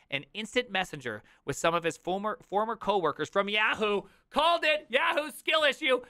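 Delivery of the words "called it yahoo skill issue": high, loud mock triumph; gloating call-out. Streamer heckles Yahoo over lost coworkers, gloating. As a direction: crow it like a cocky gamer.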